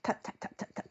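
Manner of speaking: soft unvoiced tap tap tap like a woodpecker; whispered tapping. Telling viewers about the woodpecker by her river, whispered tapping. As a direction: breathe the taps out very softly, mimicking the bird.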